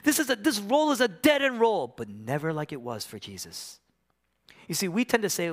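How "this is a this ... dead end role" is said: high-pitched cry of complaint; mock wail of a fed-up worker. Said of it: "Why do we cry out when we're working?".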